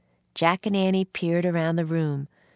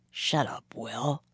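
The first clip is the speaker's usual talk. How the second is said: low, barely voiced mutter; hushed, sullen warning; 4 semitones lower, 5 dB quieter, slower than usual. A narrator's reading. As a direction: mutter it under your breath. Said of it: "he muttered".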